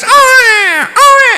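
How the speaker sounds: put-on loud, very high baby wail; piercing mock bawls; imitated child crying. Preacher imitates a small child crying, mock wailing. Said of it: "Ada anak nangis".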